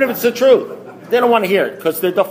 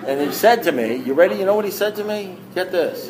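usual speaking voice

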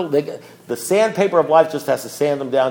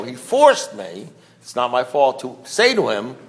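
ordinary talk

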